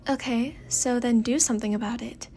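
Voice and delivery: ordinary delivery, nothing marked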